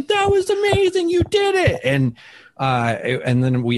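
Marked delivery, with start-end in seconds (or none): 0.0-1.8 very high, thrilled squeal; mock squeal of delight; squealing joy. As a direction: gush like a sorority sister.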